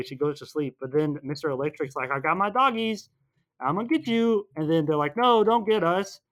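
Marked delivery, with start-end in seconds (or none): 2.1-4.5 high, playful villain taunt; mock villain threat. Recapping Mister Electric threatening the kids, mock-menacing. 5.2-6.2 high, slow, loud mock plea; mock pleading. Recapping the kids begging Mister Electric, mock-frightened.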